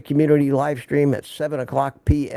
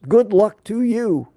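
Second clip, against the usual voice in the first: higher, slow, sarcastic good luck; mock farewell; 8 semitones higher, 4 dB louder, slower than usual. Real, not acted. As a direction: wave them off with a dry send-off. Sarcastic farewell to ignoring peers.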